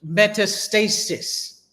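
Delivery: metastasis said very slowly, every syllable laid out; slow careful term. Shown as a demonstration. Teacher dictating the term metastasis, slowly. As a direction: drag out metastasis so students can write it. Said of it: "So metastasize and metastasis".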